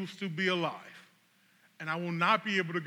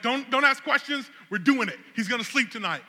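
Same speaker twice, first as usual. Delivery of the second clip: insistent; 6 semitones higher, 6 dB louder than usual. Retold or imitated. Overruling his wife's doubts.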